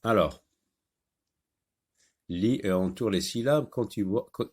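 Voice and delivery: normal talking voice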